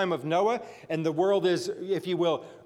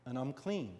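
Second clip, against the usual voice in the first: much softer, lower, savoring it; hushed wonder; 6 semitones lower, 16 dB quieter than usual. Acted. Naaman whispering to himself in disbelief that he is healed. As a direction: let it land in quiet awe.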